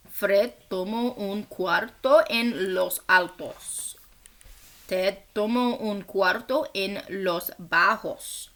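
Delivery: ordinary talk, nothing marked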